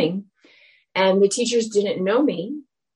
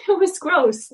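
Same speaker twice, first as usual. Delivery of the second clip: high, louder laughing repeat of It was gross; amused, laughing It was gross; 11 semitones higher, 4 dB louder than usual. Real, not acted.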